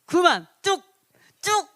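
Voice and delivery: sharp, loud stop command; 크게 외치는 명령; stern shout. Retold or imitated. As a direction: halt the crowd with a shout. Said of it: "이렇게 했다라는 거죠".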